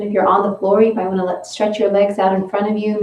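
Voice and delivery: normal talking voice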